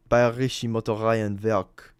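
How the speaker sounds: "Bayerische Motoreienwerk" slowly in German, with a v sound in "werk"; German pronunciation. Shown as a demonstration. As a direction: carefully demonstrate "Bayerische Motoreienwerk" as a German name. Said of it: "That's the German way to pronounce it".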